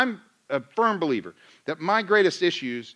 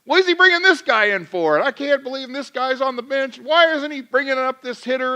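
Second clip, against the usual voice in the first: high, loud exasperated rant; mock venting; 8 semitones higher, 4 dB louder than usual. Venting to Karen about the bullpen. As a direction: rant like a fan second-guessing the manager. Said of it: "I'm like venting to Karen".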